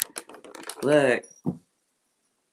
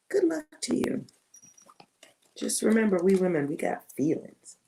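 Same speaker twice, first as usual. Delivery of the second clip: much higher, sing-song mocking take; mock high good luck; 4 semitones higher than usual. Shown as a demonstration. Reader mocking the client's luck, high sarcastic sing-song.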